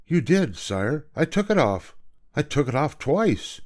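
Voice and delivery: put-on high, earnest boy's voice; protesting boy. Boy protests to the king that he obeyed, earnest. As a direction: insist innocently that you obeyed.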